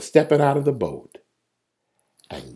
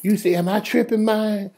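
high, softened disbelieving voice; stunned boatmates; 6 semitones higher, 6 dB quieter than usual. Acted. Man on the boat checks with his friend, high hushed disbelief. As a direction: ask your mate softly, pitched up. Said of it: "Everybody else on the boat".

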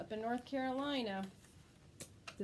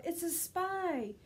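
raised alarmed call; 7 semitones higher, much slower than usual. Acted. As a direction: point and shout the alarm. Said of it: "Two newts called out".

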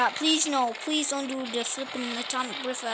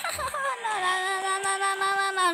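panicked no's; 7 semitones higher than usual. Real, not acted. Panicking again.

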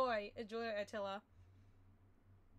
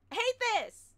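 higher, louder frustrated wail; pitched up and louder, fed up; 9 semitones higher, 7 dB louder, slower than usual. Real, not acted. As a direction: let the frustration boil over.

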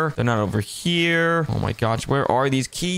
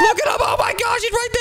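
high alarmed shout; excited alarm; faster than usual. Acted. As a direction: point him out in panic. Monster chasing them.

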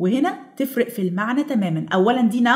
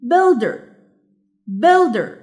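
builder with BUILD high and loud; correct first-syllable stress; 4 semitones higher, 4 dB louder, much slower than usual. Shown as a demonstration. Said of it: "which has the correct".

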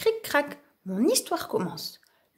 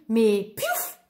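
ordinary delivery, nothing marked

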